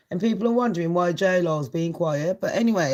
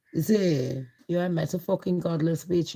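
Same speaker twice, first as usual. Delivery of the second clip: ordinary talk, nothing marked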